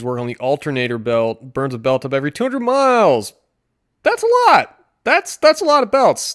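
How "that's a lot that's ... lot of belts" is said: squeaky high-pitched mock alarm; a cartoonish high squeal at the price of belts; mock shock. Reviewer mocking a car ad, high squeaky shock.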